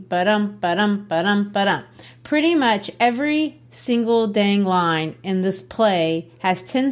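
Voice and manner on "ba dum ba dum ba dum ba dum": "ba-dum" chanted steadily, stress on "dum"; iambic rhythm. Shown as a demonstration. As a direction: beat out "ba-dum" in even pulses. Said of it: "written in iambic pentameter".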